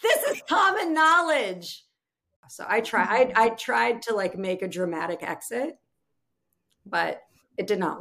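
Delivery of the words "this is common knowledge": high, louder mocking husband voice; husband scolding wife, high and exasperated. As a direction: lecture her like the obvious is obvious. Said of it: "He's like".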